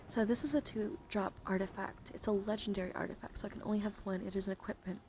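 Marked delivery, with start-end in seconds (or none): none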